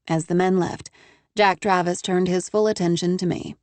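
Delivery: ordinary delivery, nothing marked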